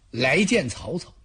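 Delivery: plain reading voice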